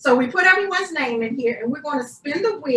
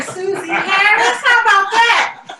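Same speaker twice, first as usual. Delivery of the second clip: hyped giveaway announcement; 5 semitones higher, 7 dB louder than usual. Real, not acted. Announcing winner, her mom.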